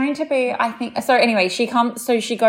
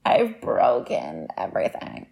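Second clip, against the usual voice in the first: 1 dramatic self-pity; 3 dB quieter, slower than usual. Retold or imitated. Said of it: "I come in and I'm like".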